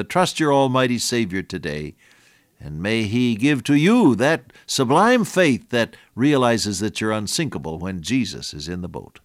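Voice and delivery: normal talking voice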